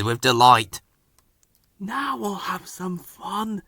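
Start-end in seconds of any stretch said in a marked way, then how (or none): 1.8-3.6 high, soft, slow conspiratorial whisper. A narrator's reading. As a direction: whisper the plan with mischief. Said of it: "whispered Ben".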